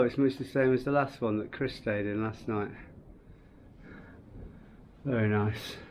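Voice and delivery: normal talking voice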